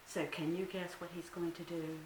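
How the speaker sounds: plain reading voice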